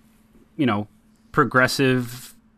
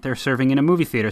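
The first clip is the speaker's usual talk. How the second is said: usual speaking voice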